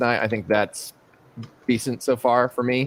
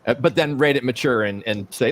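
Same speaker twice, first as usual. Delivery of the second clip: normal talking voice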